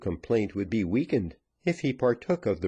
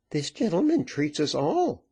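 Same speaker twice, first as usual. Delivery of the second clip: woman; 8 semitones higher than usual. Acted. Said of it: "replied the woman".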